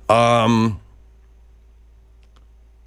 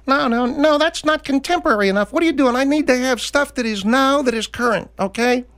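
very high squeaky upset singer. Pretend. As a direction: squeal it like a fussy little crooner. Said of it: "maybe [name] is all upset because".

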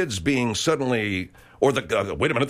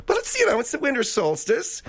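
mock falsetto atheist; 11 semitones higher than usual. Mocking atheist's answer.

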